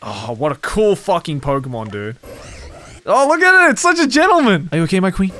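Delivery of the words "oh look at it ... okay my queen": high, delighted squeal; gushing. Real, not acted. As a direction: gush over it adoringly. Doting over gentleman Pokemon.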